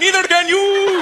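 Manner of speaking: put-on high, loud, drawn-out Indian-accented shout; mock road rage in an Indian accent; dad's accented yell. Dad yelling back at a driver, accented road rage. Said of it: "My dad".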